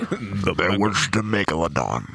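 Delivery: deep, portentous prologue; hammed-up epic narration; mock trailer narrator. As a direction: intone it low and grave. Echoes the mock movie prologue.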